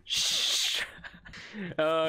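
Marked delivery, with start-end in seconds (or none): 0.0-0.8 long voiceless "shh"; shushing. Real, not acted. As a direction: hush your teammate urgently. Hushing teammate.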